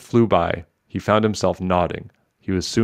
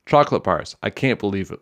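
ordinary delivery, nothing marked

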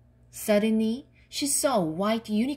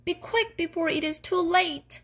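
high, urgent gnome call; urgent shout; 8 semitones higher than usual. A narrator's reading. Tied-up gnome urges Wilma to hurry, calling out. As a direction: hurry the rescuer along, pitched up. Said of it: "shouted the gnome".

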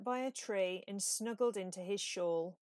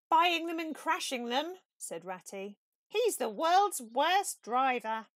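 higher, louder fed-up voice; exasperated Ratty; 5 semitones higher, 7 dB louder than usual. A narrator's reading. Ratty tells Badger about the crashes in higher, louder exasperation. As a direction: complain with exasperation.

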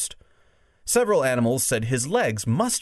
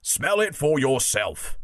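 lightly voiced bulldog, slower; a hint of a bulldog, drawn out; 3 semitones higher, slower than usual. Bulldog urging the doubters, slow lightly voiced bulldog. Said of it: "said the bulldog".

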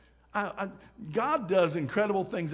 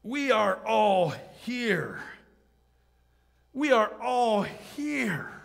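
raised, drawn-out call; Paul shouting; 7 semitones higher, 5 dB louder, much slower than usual. Acted. Apostle calling to the jailer to stop him, shouting. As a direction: call it out slowly, stretching each word. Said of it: "Paul shouted".

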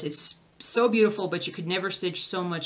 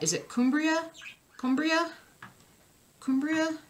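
"Cumbria" with a high questioning rise; unsure pronunciation attempt; 9 semitones higher than usual. Real, not acted. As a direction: try out "Cumbria" as a question, pitch lifting high. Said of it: "How do you say that?".

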